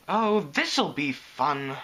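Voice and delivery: slow, drawn-out mock delight; sarcastic. A narrator's reading. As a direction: drag it out with mild sarcasm. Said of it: "whispered back sarcastically".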